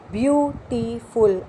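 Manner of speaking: beautiful drawn out slowly, syllable by syllable; teacher spelling out beautiful slowly by ear; slow syllable demo. Shown as a demonstration. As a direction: stretch out beautiful so they hear each syllable. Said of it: "Listen carefully।".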